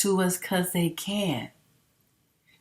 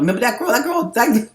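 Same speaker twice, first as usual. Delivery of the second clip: normal talking voice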